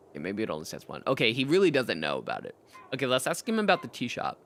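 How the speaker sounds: ordinary talk, nothing marked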